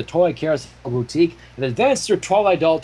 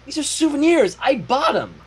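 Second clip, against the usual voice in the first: higher, defensive little dragon; protesting Spike; 9 semitones higher than usual. Acted. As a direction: protest your innocence a bit too fast. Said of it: "protested Spike".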